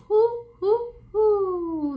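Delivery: hooting high like an owl. Shown as a demonstration. Quizzing children on owl sounds, playful hoots. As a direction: hoot it up for the children. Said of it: "That's the sound that the owls make".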